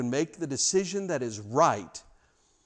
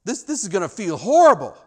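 a loud, high cry of dismay; Peter's loud protest; 6 semitones higher, 11 dB louder than usual. Acted. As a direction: Peter protests loudly to Jesus, higher and louder. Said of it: "Peter says".

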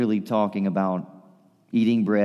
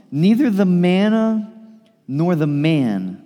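"Neither the manna" said slowly, high and loud, then "nor the man" drawn out even slower; emphatic stress; 6 semitones higher, 5 dB louder, slower than usual. Acted. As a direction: lean hard on each word. Emphatic sermon point.

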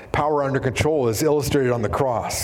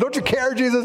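raised, pleading acted voice; mock cry for help; 10 semitones higher than usual. Fishermen calling to Jesus in the storm, mock alarm. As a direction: call out high, like an alarmed follower. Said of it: "calling for Jesus to help".

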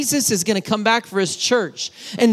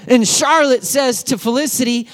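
ordinary delivery

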